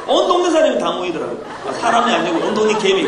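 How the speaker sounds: normal talking voice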